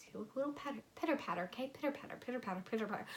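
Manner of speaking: very soft pitter-patter sound; soft rain imitation. Imitating rain hitting the side of the house, very soft. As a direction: murmur it like gentle rain on a wall.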